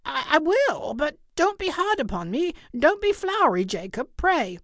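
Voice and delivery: high, stammering, scared plea; frightened pleading. A narrator's reading. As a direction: beg the ghost nervously. Scrooge quakes exceedingly.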